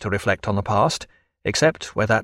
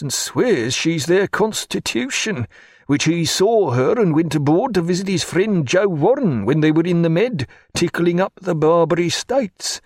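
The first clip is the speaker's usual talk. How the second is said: chatty steward Killick; 5 semitones higher than usual. Acted. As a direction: spin the deck gossip to the doctor. Killick gossiping, more amiable.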